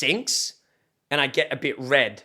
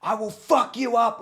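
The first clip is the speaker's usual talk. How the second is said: retold raging threat; 8 semitones higher than usual. Retelling a threat to the dog owner, high mock yell. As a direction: act out a yelled threat without full volume. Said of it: "yelling, screaming".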